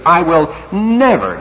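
"never" stretched out with strong emphasis; stress on never. Shown as a demonstration. Preacher stressing Peter's strong vow, "never" drawn out hard. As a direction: hold and punch the word "never". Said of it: "And it's strong, too".

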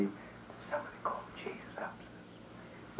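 slow secretive whisper; slow, hushed mock-conspiratorial whisper. Shown as a demonstration. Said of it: "I'll whisper it to you".

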